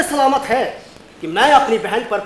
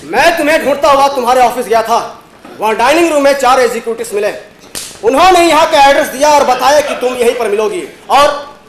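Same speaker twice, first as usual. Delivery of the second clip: excited; 5 dB louder than usual. Acted. Excited reunion with the sister.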